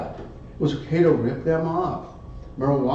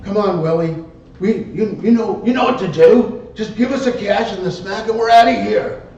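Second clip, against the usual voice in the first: demanding; 6 semitones higher, 4 dB louder than usual. Retold or imitated. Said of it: "What the Fudge had said".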